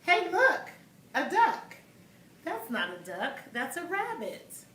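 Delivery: put-on reading to children. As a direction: act out two kids bickering for little listeners. Reads duck-rabbit argument in voices.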